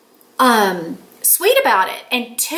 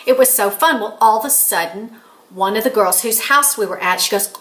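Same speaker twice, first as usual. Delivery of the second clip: normal talking voice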